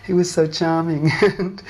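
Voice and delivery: real fond chuckle around the words; amused laughing. Real, not acted. Daughter recalling her mother taken aback by the guru, amused laughing. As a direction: talk through a light laugh, pitch lifting.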